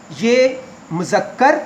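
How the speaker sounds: ordinary delivery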